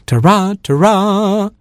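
high trumpet-call imitation; brass fanfare. Distant brass music heralding the superhero, high tooted fanfare. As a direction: toot it out like a bugle. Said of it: "a blast of brass music sounded".

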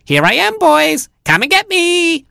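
mock vixen baiting the hounds; foxy voice, rising. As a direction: build to a shrill come-get-me. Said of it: "in a foxy voice".

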